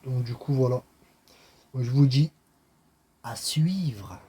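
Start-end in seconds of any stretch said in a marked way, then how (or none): 3.2-4.2 a playful sensual voice for the sign-off. Pretend. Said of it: "je fais une voix sensuelle".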